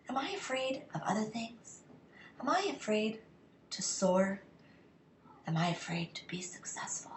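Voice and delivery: hushed, breathy self-questioning; soft searching whisper, almost unvoiced. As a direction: whisper the doubts to yourself. Whispered self-questioning during tapping.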